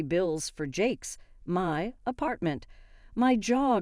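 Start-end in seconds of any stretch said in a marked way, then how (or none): none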